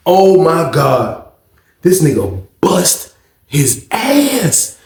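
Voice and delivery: ordinary talk, nothing marked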